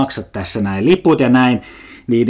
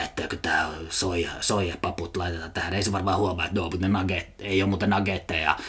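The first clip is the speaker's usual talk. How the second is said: low, much softer mumbling mock worker; mock counter worker; 6 semitones lower, 11 dB quieter than usual. Counter worker muttering to himself, mocked low and soft. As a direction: mutter it like a lazy counter clerk. Mocking Hesburger counter staff thinking.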